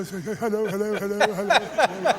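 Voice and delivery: hint of a frail old gent on the phone, rising pitch; imitating Gielgud. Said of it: "he sort of speaks like that".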